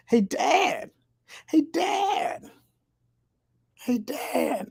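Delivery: very high cartoon boy voice; Bobby Hill. Cartoon son calling his dad, high-pitched boy impression. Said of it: "Bobby Hill voice from King of the Hill".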